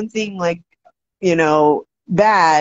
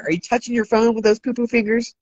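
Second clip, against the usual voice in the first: normal talking voice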